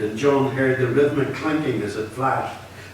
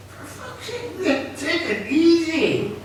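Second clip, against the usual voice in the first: pained shout; slower than usual. Acted. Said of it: "He was shouting, groaning".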